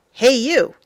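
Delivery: hey there, loud and playful; calling out. A narrator's reading. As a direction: call out to catch attention. Narrator calling out to reader.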